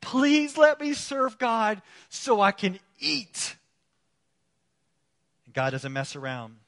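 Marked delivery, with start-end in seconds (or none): 0.0-3.6 higher, drawn-out mock plea; begging. Preacher voicing Eli's hungry descendants begging for priestly work, mock pleading. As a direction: plead slowly, hamming up the desperation. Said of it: "now they're begging".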